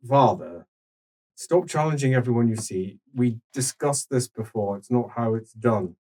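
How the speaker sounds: hint of a weary grown son; calm stern son. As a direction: scold the old man patiently. GM voices older son calmly.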